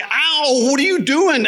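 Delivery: high, sharp ow and complaint; pained outcry. Retold or imitated. Man bumped by a small kid in Walmart, overacting pain. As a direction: cry out as if badly hurt.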